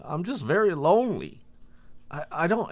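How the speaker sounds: high, pleading, lonely voice; retold tearful plea. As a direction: imitate a man pleading through tears. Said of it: "he starts crying. And he says".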